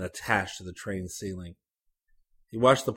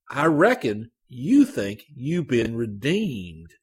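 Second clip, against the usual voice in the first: sneering, slow and louder; slower, louder repeat; 4 semitones higher, 6 dB louder, slower than usual. A narrator's reading. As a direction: repeat it slower, pressing harder. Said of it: "he repeated".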